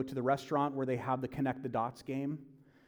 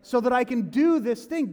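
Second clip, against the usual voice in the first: high, loud, strained griping; high-pitched exasperated rant; frustrated complaint; 7 semitones higher, 5 dB louder than usual. Retold or imitated. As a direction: whine it out, overwhelmed and confused. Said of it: "you're like".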